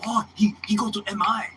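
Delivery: high, put-on voice for Yoshi; imitating the tour manager. The tour manager tells Marty about the school, in a high put-on voice. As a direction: lift it up and play the tour manager mid-introduction.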